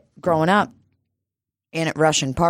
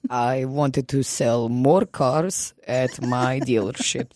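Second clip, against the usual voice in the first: mock Russian accent. Guest spins a fake backstory in a light Russian accent. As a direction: play a Russian pretending to be from Alabama. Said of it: "this crazy accent".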